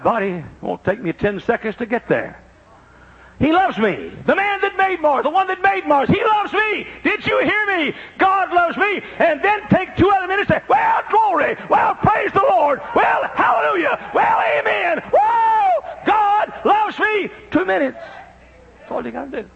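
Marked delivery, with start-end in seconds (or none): 3.4-15.0 high, loud hallelujah shouts; shouted praise. Acted. 15.1-15.9 high, loud whoop of praise; joyful whoop. Acted. 16.1-18.0 high, loud hallelujah shouts; shouted praise. Acted.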